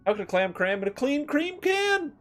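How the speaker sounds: tongue twister finally nailed in a high voice; high pitched. Shown as a demonstration. Streamer retrying the clam twister, squeaky high. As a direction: go up high for the whole line. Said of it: "this is hard".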